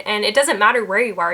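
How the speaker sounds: ordinary talk, nothing marked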